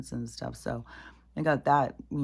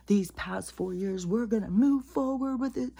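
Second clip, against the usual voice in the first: mock Kamala; 7 semitones higher than usual. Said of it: "even Kamala, it's like".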